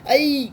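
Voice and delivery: sharp high yelp, as if pinched; sudden startled ai. Shown as a demonstration. Teacher imitating a yelp from a sudden pinch. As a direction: jump with a quick loud ai. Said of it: "अचानक से ना वो होता है".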